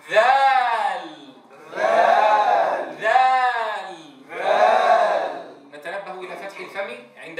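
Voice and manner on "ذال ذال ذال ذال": each ذال stretched long and loud, tongue between teeth; drawn-out letter name. Shown as a demonstration. Quran teacher demonstrates ذال to students, long and loud. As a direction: model ذال slowly and strongly. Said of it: "كيف ننطق به".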